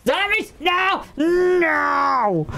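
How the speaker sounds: high panicked yelling; panicked shout. Real, not acted. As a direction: cry out as you die. Dying in game, shouting.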